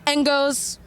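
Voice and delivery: normal talking voice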